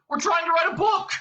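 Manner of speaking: high, loud, indignant yell; Ford yelling. A narrator's reading. Prisoner pleading his case to the captain, yelling. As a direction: shout it out in desperate protest. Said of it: "yelled Ford".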